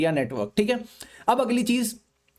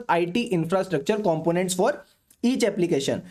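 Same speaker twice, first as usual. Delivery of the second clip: slow dictation; 3 semitones lower, much slower than usual. Shown as a demonstration. Dictating point for notes.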